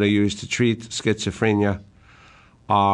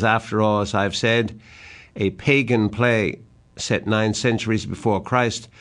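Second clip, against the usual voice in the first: normal talking voice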